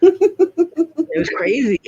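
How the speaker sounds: high, slow laugh through the words. Real, not acted. Wrapping up the phone story to a friend, laughing. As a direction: let the laugh stretch it out.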